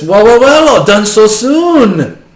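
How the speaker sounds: high, slow and smug; smug NPC voice. Acted. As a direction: savour each word from up high.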